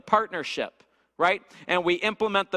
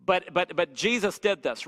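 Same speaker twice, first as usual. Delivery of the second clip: usual speaking voice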